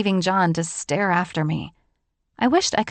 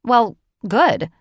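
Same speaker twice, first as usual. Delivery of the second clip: louder, clipped with poise; curt composure; 3 semitones higher, 6 dB louder than usual. A narrator's reading. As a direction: keep it tight and controlled. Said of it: "maintaining my composure".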